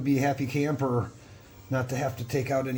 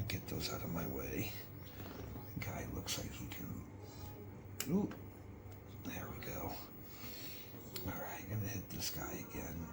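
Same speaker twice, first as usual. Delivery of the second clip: whispering; 6 semitones lower, 16 dB quieter than usual. Real, not acted.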